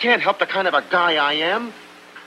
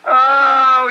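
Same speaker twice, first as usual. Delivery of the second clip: put-on loud wailing Italian-accented oh; anguished cry; 4 semitones higher, 8 dB louder than usual. Tony grieves that Buck left, a loud pained cry.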